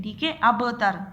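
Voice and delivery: ordinary talk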